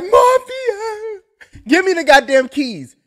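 high, cracking mock cry out to Morpheus; desperate yell. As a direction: plead at the top of your voice, hammed-up. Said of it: "I'll be like".

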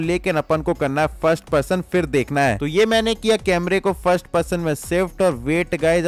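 ordinary delivery

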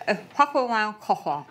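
"ich packe meinen Koffer" mumbled around a cork; mouth full. Real, not acted. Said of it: "das spricht eher undeutlich".

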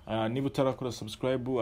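ordinary talk

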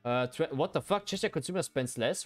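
ordinary delivery

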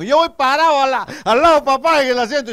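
put-on very high, loud little-boy voice; niño varón. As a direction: shout excitedly as a small boy. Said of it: "dice el varón". (niño gritón, voz muy aguda)

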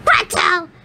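high, impatient witch's call. Acted. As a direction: call the girl sharply. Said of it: "she called out impatient".

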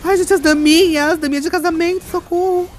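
high-pitched excited shrieking; flustered squeal. Real, not acted. Streamer reacting to an in-game marriage proposal, giddy high squeal.

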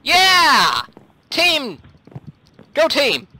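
high team cheer; rally the team with short shouts; excited rallying yells. Real, not acted.

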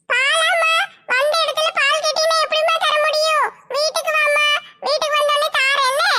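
put-on high, thin old woman's voice all through; old granny voice. Granny fobs off the asker with talk of milk, in a high old-woman voice.

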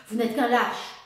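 a loud, venomous hiss of rage. A narrator's reading. Baroness hisses at the officer, loud and enraged. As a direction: spit out the insult. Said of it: "siffla Sophie, blême de rage".

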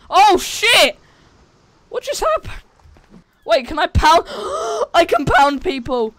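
high, loud thrilled yelps; excited shouting. Real, not acted. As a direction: gasp and cheer at the discovery. Surprise discovery in game.

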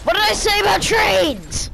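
shout. Real, not acted. Gamer yelling at the screen, fed-up shout. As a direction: yell it out, fed up.

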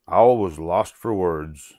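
ordinary delivery

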